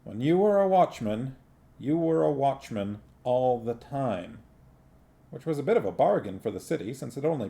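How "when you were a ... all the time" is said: plain reading voice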